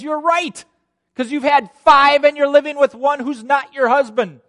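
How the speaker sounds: high, full-voiced exposing declaration; loud confrontation. Jesus confronts the woman at the well, loud and emphatic. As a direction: call out the secret at full volume.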